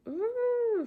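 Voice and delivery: high, drawn-out embarrassed "ooh"; mock cringing "ooh". Driver seen hitting the neighbour's car, mock cringing squeal. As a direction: imitate a high, held squeal of dread.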